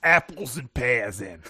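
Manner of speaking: slow put-on cockney; mock cockney. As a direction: drop it like a stage Londoner. Role-play cockney jab.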